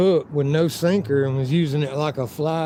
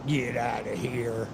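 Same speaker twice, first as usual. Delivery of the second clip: low, slow mock order from the lady; imitating angry lady; 5 semitones lower, 7 dB quieter, slower than usual. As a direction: draw it out low and soft, mimicking her. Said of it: "the lady that got mad at me".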